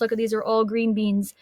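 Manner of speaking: usual speaking voice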